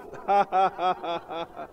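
forced, steady string of ha-ha laughs; a deliberate demonstration laugh; practice laugh. Shown as a demonstration. Preacher shows congregation how to practice a laugh, deliberate ha-ha laughing. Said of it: "practice a little laugh".

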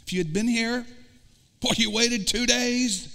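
angry accusation. Retold or imitated. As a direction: voice climbing in hurt anger. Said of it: "a little anger with God".

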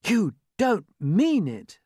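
high, slow, drawn-out disbelief; astonished cry. Acted. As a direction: cry out, stretching each word in amazement. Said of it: "they cried".

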